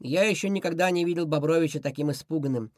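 plain reading voice

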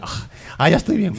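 breathy gasps then high relieved voice; mock gasping recovery. Gamer imitating NPC getting up from the ground. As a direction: wheeze then say you're fine.

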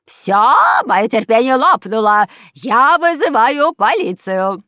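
loud, high enraged yell; furious scream. A narrator's reading. Neighbour upstairs to noisy family, deafening furious scream. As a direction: explode at full volume, stretching the last words. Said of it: "Это кричала фрау Штрудель".

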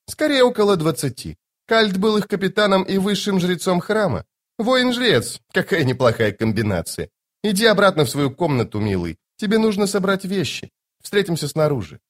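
high lady's voice, dropping on endearments; Lady Envy, coolly breezy. Acted. Lady Envy sending Toc to pack, high and breezy. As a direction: explain in a high feminine register.

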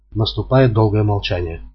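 ordinary delivery, nothing marked

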